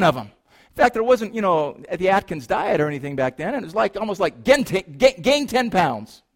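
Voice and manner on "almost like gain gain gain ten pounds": usual speaking voice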